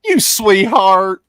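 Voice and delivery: slow, loud, drawn-out Southern coo; very slow hammed-up Southern sweetheart; mock Southern sweetness. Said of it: "teasing in the Southern gal voice".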